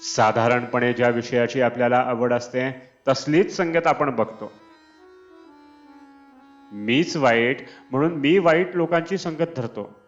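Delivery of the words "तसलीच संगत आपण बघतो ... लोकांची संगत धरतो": ordinary talk, nothing marked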